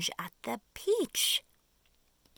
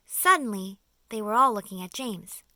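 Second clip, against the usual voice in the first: usual speaking voice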